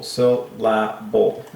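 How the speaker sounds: "Syllable" drawn out slowly in three separate beats. Shown as a demonstration. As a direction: split "Syllable" into sil, la, bull. Breaks the word into its syllables.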